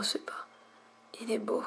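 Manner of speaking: soft admiring whisper. Real, not acted. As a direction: whisper it in awe. Whispering so family sleeps.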